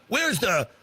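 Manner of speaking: usual speaking voice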